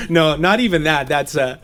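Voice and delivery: ordinary delivery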